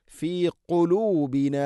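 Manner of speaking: ഖുലൂബിന with a deep throat ഖ, not ക; deep qaf. Shown as a demonstration. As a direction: sound ഖുലൂബിന from the back of the throat. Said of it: "കുലൂബിന ആവരുത്". (ഖുലൂബിന ഖാഫ് ഉച്ചാരണം)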